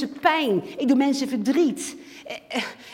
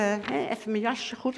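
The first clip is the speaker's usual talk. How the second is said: ordinary talk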